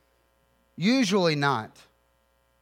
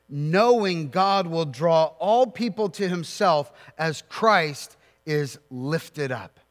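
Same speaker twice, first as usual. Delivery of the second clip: normal talking voice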